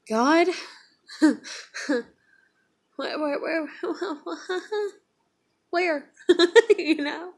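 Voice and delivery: high plea breaking into real laughter; despairing laughter. Real, not acted. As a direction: laugh helplessly while begging God for answers.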